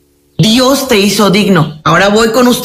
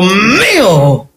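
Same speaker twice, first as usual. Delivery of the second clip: drawn-out whiny claim; mock tearful grab; possessive wife; 2 semitones lower than usual. Mocking possessive new wife.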